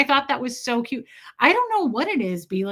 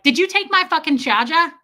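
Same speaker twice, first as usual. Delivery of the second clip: loud, higher, brash New York accent; 6 semitones higher, 7 dB louder than usual. Shown as a demonstration. Showing the only version that works, gutsy New York accent. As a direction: confront them like a New Yorker, dropping the R. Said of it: "It only works to be like".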